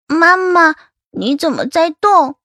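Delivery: put-on high, loud small-child voice; little daughter Nuannuan. A narrator's reading. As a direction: ask like a puzzled little girl. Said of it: "暖暖牵着我的手问道".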